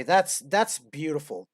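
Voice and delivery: ordinary delivery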